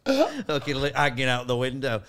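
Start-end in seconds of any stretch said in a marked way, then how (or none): none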